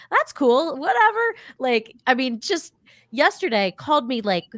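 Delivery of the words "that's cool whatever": put-on high, bored dismissive teen; teen attitude. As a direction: brush it off like a teen. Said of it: "just being like".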